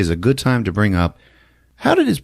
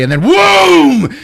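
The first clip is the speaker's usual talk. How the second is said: loud, high drawn-out whoom; mock explosion; 8 dB louder, slower than usual. Narrating his eye exploding, loud whoom. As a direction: belt out a fire burst.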